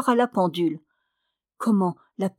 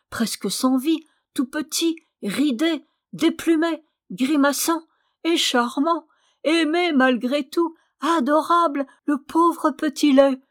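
higher and building, tender awe; a father's awe building higher over his newborn; rising wonder; 6 semitones higher than usual. A narrator's reading. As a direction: marvel at the tiny newborn, voice climbing.

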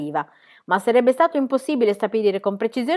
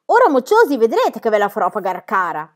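triumphant shout; 8 dB louder than usual. A narrator's reading. Book at the cave mouth threatening children, triumphant shout. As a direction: crow over the brats loudly. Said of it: "gridò trionfante".

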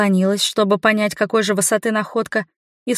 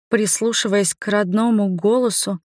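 ordinary delivery, nothing marked

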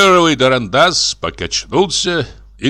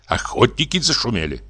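ordinary delivery

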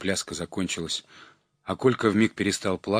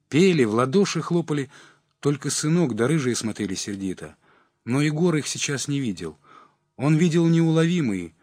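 ordinary delivery, nothing marked

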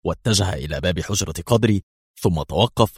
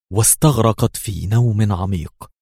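ordinary delivery, nothing marked

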